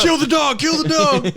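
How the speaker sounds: high yelled raid command; shouting. Pretend. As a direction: cop barking orders in a raid. Acted police raid.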